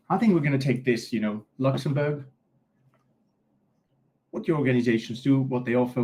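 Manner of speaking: normal talking voice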